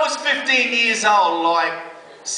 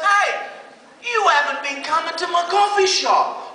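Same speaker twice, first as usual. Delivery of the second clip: wounded youth worker; 9 semitones higher than usual. Retold or imitated. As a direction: complain like you've been snubbed.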